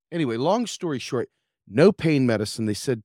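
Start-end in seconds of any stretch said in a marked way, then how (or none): none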